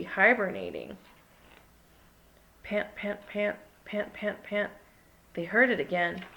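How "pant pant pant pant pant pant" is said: soft panting. A narrator's reading. Panting noise.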